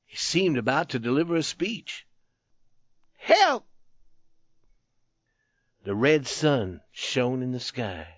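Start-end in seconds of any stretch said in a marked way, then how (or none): 3.2-3.7 high, loud cry for help; desperate cry. Acted. Youth crying out in anger at the battlefield, a high loud cry. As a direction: fling it out at the battlefield.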